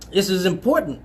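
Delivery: normal talking voice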